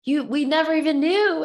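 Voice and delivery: high, loud astonished cry; stunned disbelief. Retold or imitated. Running friends stunned by her MS news, loud high astonished cry. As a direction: gasp it out in amazement.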